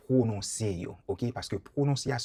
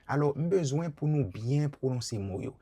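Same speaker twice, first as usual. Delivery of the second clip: ordinary delivery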